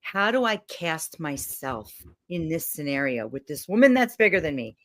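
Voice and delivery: ordinary delivery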